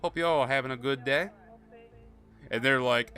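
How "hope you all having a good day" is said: louder, sugary put-on accent with a rising end; put-on drawl accent. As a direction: sing it out in a fake folksy accent. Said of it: "I put on a fucking accent and everything".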